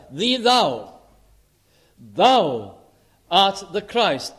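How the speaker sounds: loud punched Thou, slow art; stress on thou. Shown as a demonstration. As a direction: hit Thou hard then slow down. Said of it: "have that thou in the singular".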